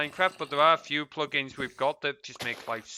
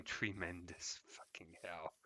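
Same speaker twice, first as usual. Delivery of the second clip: unvoiced whispered sarcasm and curse; 8 semitones lower, 18 dB quieter than usual. Real, not acted. Whispering in disgust at the game. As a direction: breathe it out, deflated.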